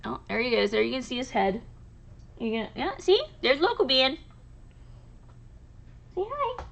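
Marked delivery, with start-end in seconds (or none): 2.4-6.6 pet talk. Real, not acted. Coaxing her husky to greet the camera in sweet pet talk. Asking the dog to greet viewers.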